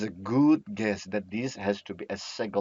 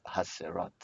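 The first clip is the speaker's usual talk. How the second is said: "ha, se, rot" run together softly, much quieter; quiet confirming repeat; 5 semitones lower, 10 dB quieter than usual. Shown as a demonstration. Teacher softly repeats "ha, se, rot" to approve the reading. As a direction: murmur "ha, se, rot" back as a quick soft check. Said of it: "Yes, very good".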